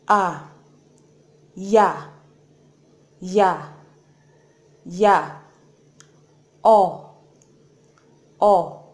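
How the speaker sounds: each vowel said loud and clear in turn; Korean vowel drill. Shown as a demonstration. Teacher repeating all ten vowels for learners. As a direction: drill the vowels slowly with pauses. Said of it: "So I'll take it again".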